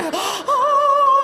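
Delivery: high sharp gasp of wonder; loud awed gasp. Pretend. Man in love with life after near death, loud awed gasp. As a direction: gasp as if seeing the sky anew.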